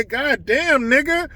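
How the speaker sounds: a hint of a player's casual tone; mock casual quote. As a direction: drop it casually as a hypothetical player.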